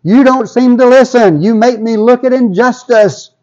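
high, loud, exasperated plea to God; acted-out protest of an unheard prophet; crying out in complaint. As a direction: plead indignantly, voice pitched well up. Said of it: "he cries out and he says".

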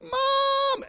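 high, wailing cry of Mom!; child crying for mom. Retold or imitated. Fallen child on the playground calling for mom, high crying wail. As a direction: call out like a hurt child across a playground. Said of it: "your child falls down and goes".

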